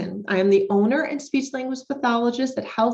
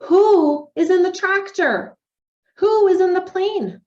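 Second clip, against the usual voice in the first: slow, bright questions asked high and louder; reading to a child; 6 semitones higher, 4 dB louder, slower than usual. Shown as a demonstration. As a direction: ask it like you're coaxing a toddler to answer. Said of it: "We can talk about who, where responses".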